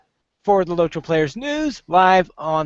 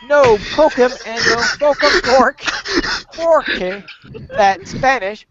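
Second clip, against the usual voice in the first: hammed-up poem reading; 7 semitones higher than usual. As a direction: declaim the nonsense verse like grand poetry. Reads a silly poem aloud.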